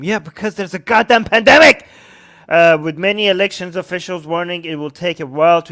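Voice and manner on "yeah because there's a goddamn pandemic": louder, fed-up retort; exasperated outburst. Real, not acted. Streamer answering a clueless question, loud exasperated. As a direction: snap back at the obvious question.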